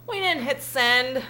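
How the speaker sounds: a slowed, sing-song put-on voice; mock receptionist. Clinic staff excusing the delay, in a mock slow voice. Quoting the clinic's phone reply.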